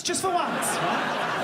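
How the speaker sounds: hammed-up exasperated outburst; mock exasperation. British girl back from America, mock-exasperated plea. Imitated girl pleads her point.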